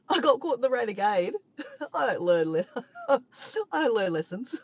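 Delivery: words broken by laughter; laughing. Real, not acted. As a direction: laugh through it at yourself. Laughing at herself.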